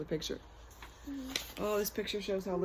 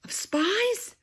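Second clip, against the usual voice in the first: pitched way up in wonder; amazed; 11 semitones higher, 3 dB louder, much slower than usual. A narrator's reading. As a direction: marvel at it, voice leaping up. Storyteller's amazed question.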